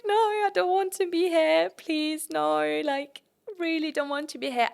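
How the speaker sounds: high, loud wail of protest; whiny plea, starting loud and easing off; retold tearful pleading. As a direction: beg like a crying child, pitched high. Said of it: "And I started crying".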